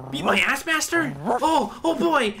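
high, loud, eager dog voice; excited golden retriever. Acted. Mocking a friend as an excited retriever, high and eager. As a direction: yelp it like a thrilled puppy. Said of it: "like an excited golden retriever".

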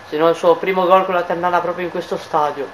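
normal talking voice

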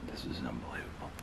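breathless, stunned whisper; awed whisper. Real, not acted. Alone in the dark building, stunned, in a hushed whisper. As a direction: let the words slip out in a hush.

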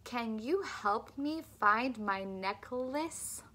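"love life" with slow, clear L; slow, careful speech-therapy model; slow clear L drill. Shown as a demonstration. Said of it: "One more for medial".

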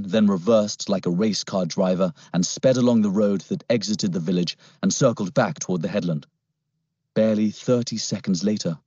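plain reading voice

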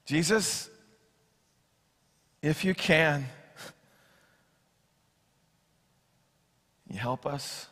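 soft, halting plea, fading away; pleading father. Acted. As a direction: beg quietly with long pauses. Desperate father's cry.

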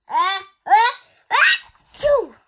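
loud high sneeze with giggles; laughing sneeze. Acted. Gerald sneezing at Doctor Cat, giggly loud sneeze. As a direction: build and burst, giggling.

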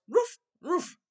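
two high mock woofs; playful barking for the class; dog bark. Shown as a demonstration. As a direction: yap twice like a dog. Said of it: "we're gonna say roof".

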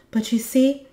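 high, loud, hurried excuse; impatient. A narrator's reading. Moominmama hops about to be off, impatient. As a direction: start explaining impatiently. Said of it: "hopping up and down with impatience".

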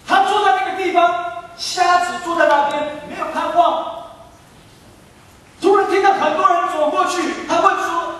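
high, ringing preacher's voice; fervent sermon delivery, pitched high; impassioned preaching. Acted. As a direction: preach it with rising urgency. Preaches the story at full force.

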